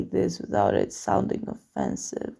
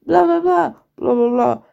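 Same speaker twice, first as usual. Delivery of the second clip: louder, higher blah-blah squabble; mock arguing; 4 semitones higher, 5 dB louder than usual. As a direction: imitate a heated row in nonsense. Imitating parents' senseless arguing.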